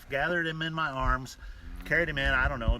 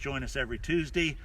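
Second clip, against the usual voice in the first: usual speaking voice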